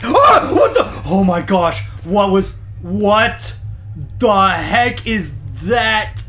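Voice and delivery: loud startled outburst; startled fright. Real, not acted. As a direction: react to a sudden scare. Jump scare in game.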